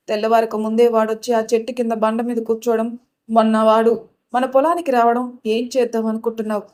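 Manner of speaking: plain reading voice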